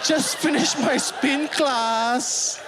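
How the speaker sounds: high, drawn-out phone-video voice; gushy vlogger greeting. Retold or imitated. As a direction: greet followers in a high, stretched-out singsong. Mimics posting a selfie video.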